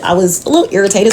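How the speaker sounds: normal talking voice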